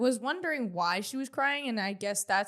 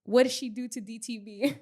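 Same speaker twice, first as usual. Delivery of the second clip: normal talking voice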